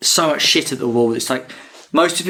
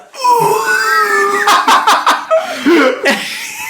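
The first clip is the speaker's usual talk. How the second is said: laughing wail; 5 dB louder than usual. Retold or imitated. Villain hit in stomach.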